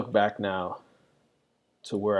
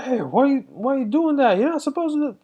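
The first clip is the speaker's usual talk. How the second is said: high, louder parental scolding; raised voice; 6 dB louder than usual. Retold or imitated. Dad catching his kid misbehaving, raised voice. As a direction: re-enact scolding a kid, voice jumping way up. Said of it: "raise my voice, be like".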